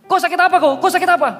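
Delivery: high, loud insistent asking; loud repeated question. Retold or imitated. Preacher loudly pressing the sick man, high. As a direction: shout the question over the noise.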